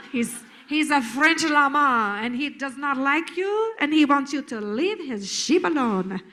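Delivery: put-on silly French llama accent; mock French accent. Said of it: "we say he's French".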